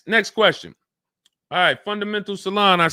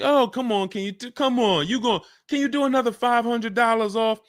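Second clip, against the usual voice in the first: higher-pitched pleading haggler; mock begging, pitched up and insistent; 6 semitones higher than usual. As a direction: plead for a few dollars off, stumbling over yourself. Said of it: "feeling like you're begging".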